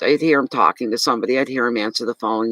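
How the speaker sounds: ordinary talk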